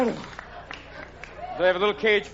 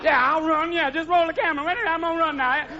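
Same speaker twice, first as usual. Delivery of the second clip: a sedated beast back on set, pitched up; drugged animal; 8 semitones higher than usual. Retold or imitated. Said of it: "the animals come back next week going".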